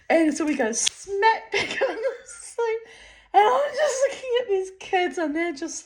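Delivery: laughing. Real, not acted. Laughing at landing on the seat.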